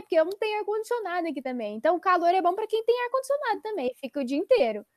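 ordinary talk, nothing marked

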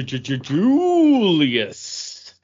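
drawn-out stuttered Julius; stumbling over the name. Real, not acted. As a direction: stammer out Julius, fighting for the word. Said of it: "Did I pronounce it right?".

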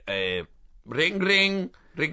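sings ring ring like a phone; hammed-up ring ring; phone ring imitation. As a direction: mouth a phone ringing. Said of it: "that's you making the phone noise".

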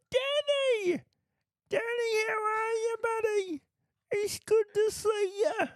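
pub bloke hailing a mate. Acted. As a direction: put on a pub regular's greeting. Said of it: "I do the bloke down the pub".

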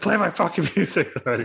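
high, loud demand breaking into laughter; laughing mock shout. Vampiro yelling again at the barista, laughing. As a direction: yell it through a laugh.

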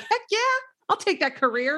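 high, keen mock enthusiasm; imagined eager reply. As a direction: play someone jumping at the offer. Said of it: "Most people would be like".

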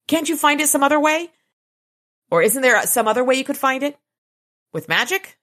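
quick, high-pitched questioning; impatient pressing. A narrator's reading. Murphy pressing Dresden for another way to the cave, impatient.

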